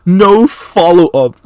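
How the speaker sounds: high, slow, incredulous mimicking; mock brazen. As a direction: draw it out high to mock his nerve. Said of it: "had the brass neck to go".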